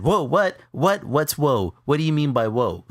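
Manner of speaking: high, loud alarmed whoa what; panicked questioning. Retold or imitated. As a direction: snap back in sudden alarm at the nurse. Panicking after nurse's whoa.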